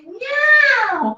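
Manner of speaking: high playful cat meow. Acted. Cat answering the baby bird, playful high meow.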